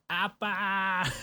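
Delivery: high goofy shout of a nonsense word; a gag yell pitched high; silly noise. Retold or imitated. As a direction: blurt it like a comic gag. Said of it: "Higashimura just runs across and goes".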